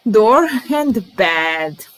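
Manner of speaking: drawn-out announcing of new words; slow, drawn out. Shown as a demonstration. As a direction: stretch each word slowly. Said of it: "teacher announces new words".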